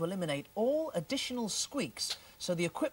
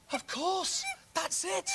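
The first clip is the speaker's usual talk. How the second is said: gasp, then high excited exclamations; sudden realization; 7 semitones higher, much slower than usual. Acted. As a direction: burst out as the idea hits. Sudden realization about the mice.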